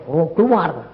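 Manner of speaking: ordinary delivery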